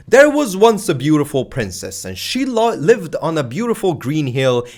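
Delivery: sweet fairy-tale narration; reading to a child. Shown as a demonstration. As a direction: tell it like a bedtime story to a kid. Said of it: "how would you read a book for a child?".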